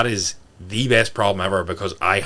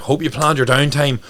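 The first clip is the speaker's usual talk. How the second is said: chirpy check-in; 6 semitones higher, 3 dB louder than usual. Retold or imitated. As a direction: pump them up with each wish. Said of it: "I'm like".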